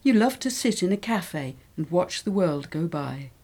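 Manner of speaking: usual speaking voice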